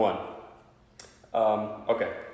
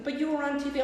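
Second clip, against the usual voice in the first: niece voice; faster than usual. Retold or imitated. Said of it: "She goes".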